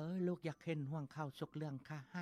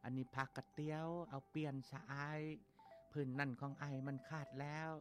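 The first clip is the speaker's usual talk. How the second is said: put-on tender girl's voice; 4 semitones higher, 3 dB quieter than usual. Girl gives gifts to orphan.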